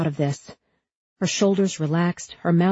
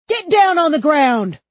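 too-high, loud screamed order; hysterical shout; 10 semitones higher, 8 dB louder than usual. A narrator's reading. Second cop, finger on trigger, hysterical shout. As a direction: yell with panic driving the pitch up. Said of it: "Hysteria pitched his voice up too high".

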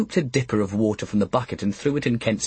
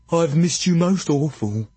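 simple giant voice; 5 semitones higher than usual. A narrator's reading.

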